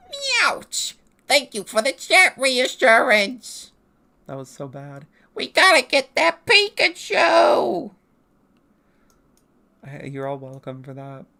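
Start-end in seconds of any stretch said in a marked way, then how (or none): 0.1-0.9 squeaky cartoon cat-villain yelp, much higher; Meowth from Team Rocket. Streamer turns the Pokémon's yelp into a Meowth cry. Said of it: "Let me say it like Meowth from Team Rocket". 1.3-3.7 high, slow cartoon cat-villain voice, louder; Meowth voice. Streamer thanks chat in the Meowth voice. 5.3-7.9 high, loud, slow cartoon cat-villain shout; Meowth shout. Streamer does Team Rocket's catchphrase as a Meowth shout. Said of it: "really good Meowth impression".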